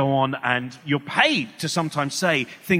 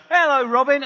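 bright raised hello; cheery raised hello; man's greeting; 10 semitones higher, 6 dB louder than usual. Retold or imitated. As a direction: greet him cheerily, pitched up. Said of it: "he went".

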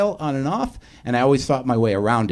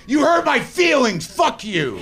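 high, drawn-out mock scream; hurt rage; slower than usual. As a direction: shriek it like a wounded, self-aware rager. Replays his ineffectual, sensitive rage.

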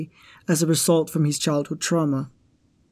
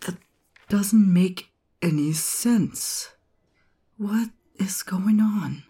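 uneasy whisper; 2 semitones higher, slower than usual. Acted. Alone, finding something strange.

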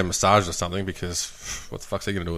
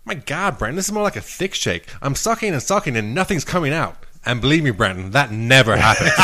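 put-on American accent; 3 semitones higher than usual. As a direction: play up the put-on American accent as you complain. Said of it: "She had, like, American accent".

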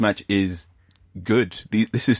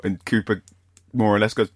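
ordinary talk, nothing marked

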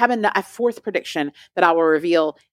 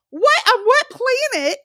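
much higher, incredulous shriek of disbelief; mock outrage. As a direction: balk at the ad as if insulted.

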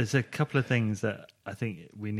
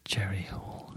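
ASMR whisper; 6 dB quieter, slower than usual. Pretend. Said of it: "A S M R voice".

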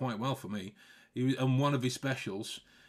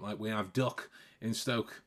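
ordinary talk, nothing marked

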